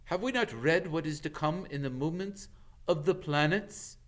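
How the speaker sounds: lofty, wondering proclamation; solemn centaur. A narrator's reading. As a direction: declaim it to the stars. Centaur Ronan declaims to sky.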